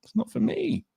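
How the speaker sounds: hammed-up high whine repeating the refusal; high mock whine. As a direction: squeak it out like a sulking kid. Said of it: "I was like".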